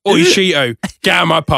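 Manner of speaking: loud, gruff East London landlord bark; mock Cockney pub landlord shouting Oi; Phil Mitchell accent. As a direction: throw the cat out like Phil Mitchell in his pub. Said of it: "he's got Phil Mitchell's accent".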